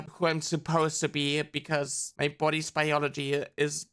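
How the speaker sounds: ordinary delivery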